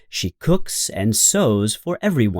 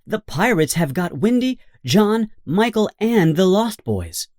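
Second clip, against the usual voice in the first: higher, tumbling urgent news; urgent warning; 7 semitones higher than usual. A narrator's reading. Tinker Bell warns Peter, urgent higher alarm. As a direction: rush the alarm out. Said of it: "Tinker Bell flies in and says".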